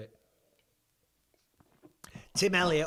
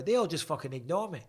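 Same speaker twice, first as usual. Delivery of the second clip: ordinary talk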